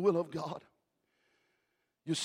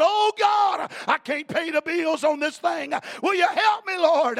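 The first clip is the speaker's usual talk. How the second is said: high, loud pleading cry; crying out to God; 12 semitones higher, 11 dB louder, slower than usual. Retold or imitated. Preacher acting himself in the whale's belly, crying out to God loud and high. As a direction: beg the Lord from the whale's belly. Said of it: "crying out".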